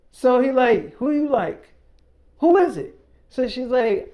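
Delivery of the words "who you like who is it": higher-pitched, pressing interrogation, acted out; imitated insistent questioning; mock demanding questions. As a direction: demand an answer as the jealous boyfriend. Acted boyfriend demanding the name.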